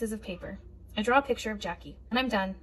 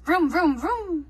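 louder, higher playful engine sound; car engine vroom; 6 semitones higher, 4 dB louder, much slower than usual. A narrator's reading. Kids pushing toy cars in the sandbox, playful engine noise. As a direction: make a toy car rev.

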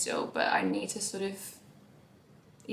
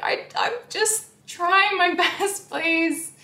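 laughing plea; 11 semitones higher, 6 dB louder, much slower than usual. Retold or imitated. Pleading with the haters.

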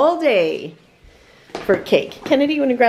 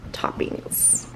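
whispered; 13 dB quieter than usual. Real, not acted. Quiet aside while showing dinner.

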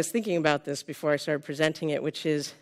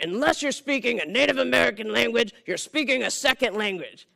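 bellowing guy, raised pitch; John; 6 semitones higher, 4 dB louder than usual. Retold or imitated. Speaker imitating a friend lecturing everybody on second languages, bellowing. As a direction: imitate an alarmingly forceful bellower. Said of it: "he would say it in this voice".

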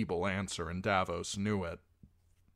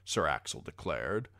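usual speaking voice

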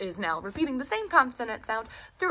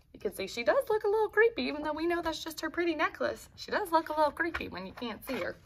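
usual speaking voice

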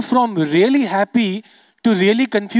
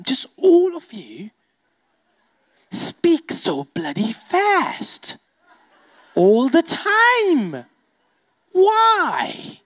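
exaggerated mock British accent, higher and drawn out; 3 semitones higher, much slower than usual. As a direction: play an exasperated Englishman, slowing right down. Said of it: "people from England always telling me".

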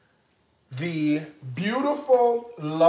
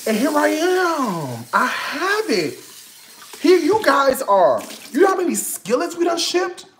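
higher, voice climbing with giddy disbelief; emotional excitement; 6 semitones higher than usual. Real, not acted. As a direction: marvel at it, almost overcome. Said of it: "I'm about to get emotional".

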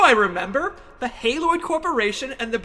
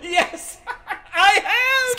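high, cracking laugh through the words; can't stop laughing while answering; words bursting out between giggles; laughing madly; 7 semitones higher, much slower than usual. Acted. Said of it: "He won't stop laughing".

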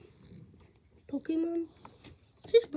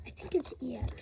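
whispering; 3 semitones lower, 10 dB quieter, faster than usual. Real, not acted. Quick whispered aside.

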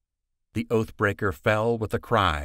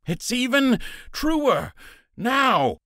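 much higher, slow, breathless words; gasped out; 3 dB louder, slower than usual. Acted. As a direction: squeeze it out between gasps. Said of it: "he gasped".

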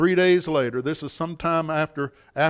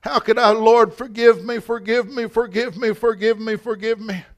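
acted remorseful plea, slightly raised pitch; repentant pleading; 5 semitones higher than usual. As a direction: beg over and over for forgiveness, fading. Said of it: "he was repenting".

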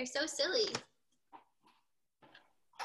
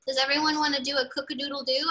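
usual speaking voice

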